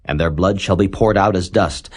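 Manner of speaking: usual speaking voice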